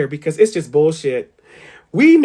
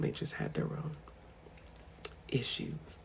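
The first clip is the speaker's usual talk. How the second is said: whispered aside; 8 semitones lower, 23 dB quieter than usual. Real, not acted. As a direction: whisper it very softly. Trailing whisper.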